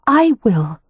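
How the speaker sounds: high, firm insistence; insisting. A narrator's reading. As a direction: insist on doing it herself. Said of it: "she insisted".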